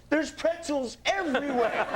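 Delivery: high, laughing punchline; high stranger voice. Retold or imitated. Bystander reports pretzels to the wife, high and laughing. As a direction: deliver it high through a laugh. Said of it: "And he says".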